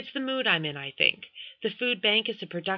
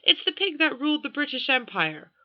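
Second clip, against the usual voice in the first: put-on British royal accent pitched high; pompous British accent, much higher; Prince Charles impression; 8 semitones higher than usual. Julian mocking a royal, British accent. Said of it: "in a fair imitation of Prince Charles".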